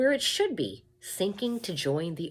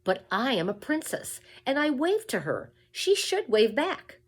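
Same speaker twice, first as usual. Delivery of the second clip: high, louder pouty princess, slowing at the end; spoiled princess; 10 semitones higher, 5 dB louder than usual. A narrator's reading. Princess complaining to the king that Mig should wave back. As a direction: insist like a pampered little girl.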